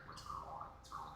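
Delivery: gentle checking-in. Acted. Brushing a companion's hair while checking on them, softly murmured. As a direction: murmur it like a light stroke of the hair. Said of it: "lightly brushes your hair in that".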